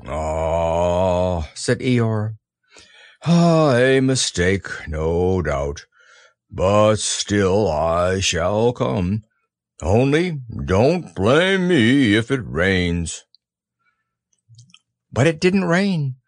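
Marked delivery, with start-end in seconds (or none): 0.1-1.5 slow, low gloomy donkey; Eeyore voice. Acted. Eeyore speaking. 3.2-13.2 slow, low gloomy donkey; Eeyore voice. Acted. Eeyore speaking.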